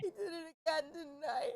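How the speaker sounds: put-on high, tearful little girl; a small child sobbing out the words; little girl's voice, way up and crying. Child April crying to mother.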